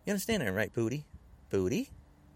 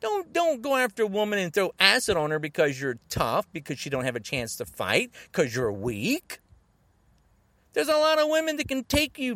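loud, raised-pitch scolding; angry rant; 5 semitones higher, 7 dB louder than usual. Real, not acted. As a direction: tear into cowards with loud scorn.

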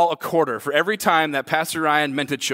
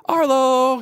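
calling out; 7 semitones higher, 3 dB louder than usual. Retold or imitated. Father calling lost son in store.

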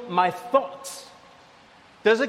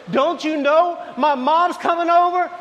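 exasperated wife; 7 semitones higher than usual. Retold or imitated. Wife reminding her husband her mom is coming, high and exasperated. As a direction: snap it like an annoyed wife. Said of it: "she says".

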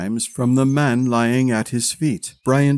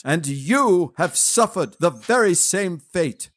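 higher, impassioned vow of revenge; raised voice swearing vengeance; crying out a vow; 6 semitones higher than usual. A narrator's reading. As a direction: hurl the oath at an absent brother. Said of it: "he cried aloud".

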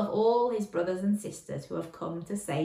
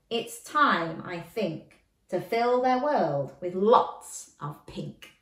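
usual speaking voice

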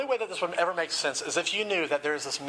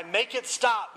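higher, pained plea; anguished begging; 6 semitones higher, slower than usual. A narrator's reading. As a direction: beg for the pain to end. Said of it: "began to scream in more anguish".

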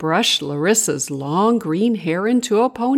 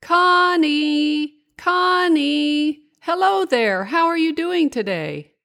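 high, sing-out call to a friend; cheery storybook hail, pitched way up; 10 semitones higher than usual. A narrator's reading. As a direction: call her name in long, high notes. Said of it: "Larissa called out to her".

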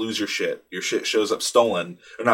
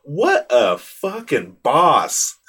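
amused admiration; 5 semitones higher, 5 dB louder, much slower than usual. Retold or imitated. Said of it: "I was just like".

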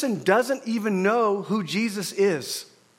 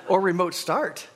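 normal talking voice

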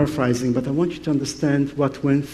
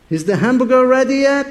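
calling out; 9 semitones higher, 5 dB louder than usual. Retold or imitated. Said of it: "he's saying".

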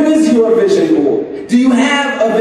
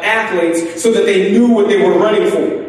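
ordinary delivery

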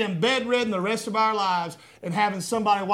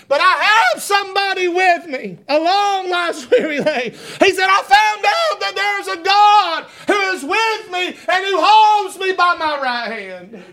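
laughing; 9 semitones higher, 4 dB louder than usual. Real, not acted. Laughs mid-preaching.

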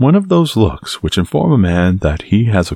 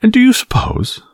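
very high protesting question; crying out. A narrator's reading. Young Gascon starts to challenge Aramis, crying out high. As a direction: throw the question back, pitched way up. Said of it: "cried D'Artagnan".

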